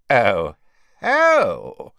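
big, swooping cry of recognition; loud realisation. A narrator's reading. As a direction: burst out as it fully dawns. Slughorn exclaims again, louder.

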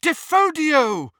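high, loud, drawn-out incantation; yelled spell. A narrator's reading. Hermione blasting the passage wider, high yelled spell. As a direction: yell the spell, stretching it out high. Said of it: "he heard Hermione yelling".

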